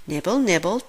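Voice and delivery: ordinary delivery, nothing marked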